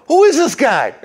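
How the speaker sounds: very high, loud incredulous cry; astonished crowd. Retold or imitated. Crowd asking who Jesus is, high and loud. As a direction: squeal it in disbelief. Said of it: "People go".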